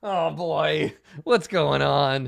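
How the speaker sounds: high, loud gnoll panicking; alarmed gnoll. Acted. Gnoll watches the dwarf ruin the fish, alarmed and loud. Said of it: "he keeps making gestures like".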